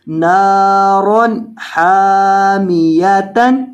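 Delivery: drawn-out Quran reading of each word; stretched tajweed recitation; slow recitation. Shown as a demonstration. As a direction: recite slowly and clearly for the student. Teacher recites Quranic words.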